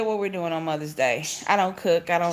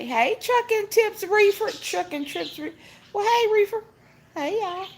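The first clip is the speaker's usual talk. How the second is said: sing-song greeting; 11 semitones higher than usual. Real, not acted. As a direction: stretch the name up high. Greeting viewer on live stream.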